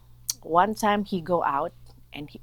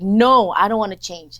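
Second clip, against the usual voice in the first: loud, higher retold protest; angry refusal; 4 semitones higher, 12 dB louder than usual. Woman retelling throwing the book down, angry. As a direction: refuse hotly, leaning hard on the no. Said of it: "I get angry".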